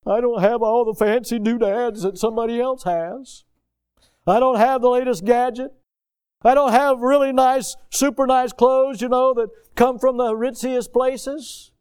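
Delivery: a preacher mocks his congregation's whining; whiny complainer. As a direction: ham up a spoiled grumbler moaning woe is me. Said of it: "And yet we complain".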